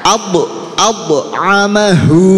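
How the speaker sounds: "Ab" bounced on b, "amahu" slow and drawn out; qalqalah. Shown as a demonstration. Teacher leads a repeat-after-me drill, qalqalah on "Ab". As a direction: bounce "ab", hold the end of "amahu". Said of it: "Nggih, qalqalah".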